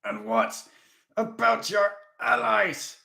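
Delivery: high, slow shout. A narrator's reading. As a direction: throw the question at him. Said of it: "Gannon Haas shouted".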